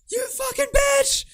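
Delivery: shrieking high mock rant at the girlfriend; mocking the streamer yelling at his crying girlfriend, high-pitched screaming. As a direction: scream it in a shrill, exaggerated parody of him. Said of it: "He's just screaming at her".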